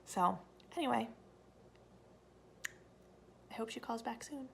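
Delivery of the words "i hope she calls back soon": soft unvoiced whisper. Real, not acted. Whispering near sleeping kids.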